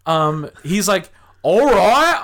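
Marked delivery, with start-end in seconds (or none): 1.4-2.2 high, loud mock-Aussie "all right"; imitated Australian exclamation. Player hearing the rule, loud imitated Australian cry. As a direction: holler it in a put-on Australian accent.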